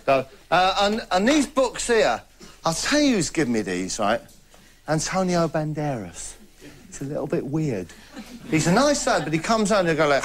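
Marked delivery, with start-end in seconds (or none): none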